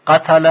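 قتل voiced with three short a vowels; read as "qatala". Shown as a demonstration. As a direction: say قتل as qa-ta-la, every letter with a short a. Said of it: "این کلمه رو چگونه باید بخونیم".

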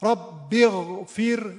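اغفر drawn out, ghayn slightly heavy but not thick; just enough tafkhim. Shown as a demonstration. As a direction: keep a measured heaviness on the غ in اغفر. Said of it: "این کافیه".

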